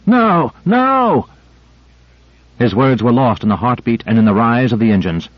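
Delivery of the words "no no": shouting. A narrator's reading. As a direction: yell it to stop them. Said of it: "shouted Michaels".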